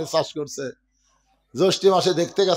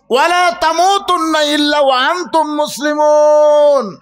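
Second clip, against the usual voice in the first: high, loud sermon cry; full-force preaching; 11 semitones higher, 5 dB louder than usual. Real, not acted. Preacher telling believers not to fear going, full-force preaching. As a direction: hurl it at the crowd in a raised, sustained pitch.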